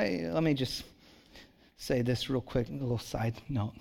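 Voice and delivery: normal talking voice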